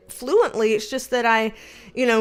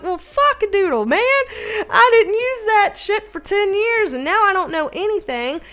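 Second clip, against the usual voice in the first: exasperated outburst; 7 semitones higher than usual. Retold or imitated. Reacting to ten years of unused Spanish, comically dismayed. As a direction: kick yourself in a raised voice.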